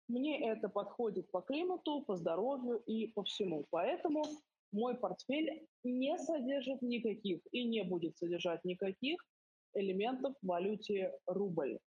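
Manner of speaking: normal talking voice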